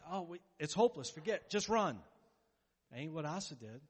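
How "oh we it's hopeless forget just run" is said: hopeless panic. Retold or imitated. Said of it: "what the devil wants us to".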